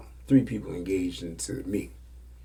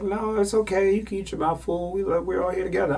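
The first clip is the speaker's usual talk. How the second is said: put-on higher, sweet girly voice, a bit fast; girl's voice; 11 semitones higher, 4 dB louder than usual. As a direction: play the girl reassuring him. Girl reassures him, voiced high.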